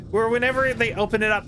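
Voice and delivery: ordinary talk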